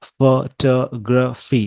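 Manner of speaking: Photography broken slowly into fuh-TAH-gruh-fee; slow, four separated beats on photography; syllable by syllable. Shown as a demonstration. Teacher showing the word's four breaking points, syllable by syllable. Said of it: "four breaking point".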